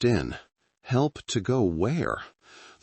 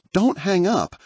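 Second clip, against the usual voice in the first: high, loud panicked plea; sharp panic; 12 semitones higher, 5 dB louder than usual. A narrator's reading. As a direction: beg Dad in sudden raw panic. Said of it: "The panic was back, sharp and raw".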